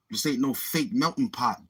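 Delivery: usual speaking voice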